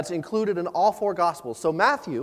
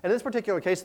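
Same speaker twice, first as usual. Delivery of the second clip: normal talking voice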